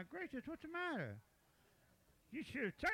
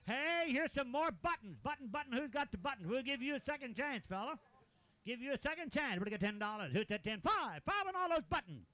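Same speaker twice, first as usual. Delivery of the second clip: auctioneer; 7 semitones higher than usual. Real, not acted. Auctioneer calling bids.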